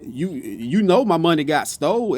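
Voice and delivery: normal talking voice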